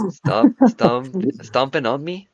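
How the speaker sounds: high, loud, incredulous echo; loud high-pitched mimicking disbelief; exaggerated mock repeat. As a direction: squawk the misheard words back as a question. Mock repeat of misheard words.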